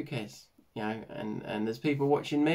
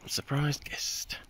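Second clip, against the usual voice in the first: whispering; 5 dB quieter, much slower than usual. Real, not acted. Teasing a guest, hushed.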